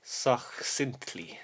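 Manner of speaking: "Succinctly" very slow, suck-sint-lee; softly stretched "Succinctly", testing each part; careful pronunciation. Shown as a demonstration. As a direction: sound out "Succinctly" syllable by syllable with a k. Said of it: "how to pronounce?".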